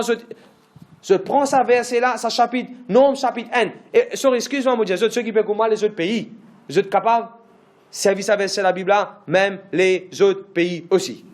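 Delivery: ordinary delivery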